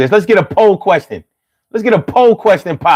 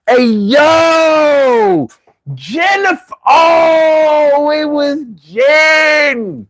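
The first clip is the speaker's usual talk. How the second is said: high Philly holler, words dragged way out; drawn-out Philly accent; 8 semitones higher, much slower than usual. Shown as a demonstration. Hollering at viewers by name, dragged-out Philly accent. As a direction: wail the name in exaggerated, stretched Philly style. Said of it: "just drag out my words for no good reason".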